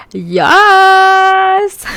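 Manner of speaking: very high, loud, long-held cheer; excited squeal. Acted. Friend answering the audition text, excited squeal. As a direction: shriek it out in delight.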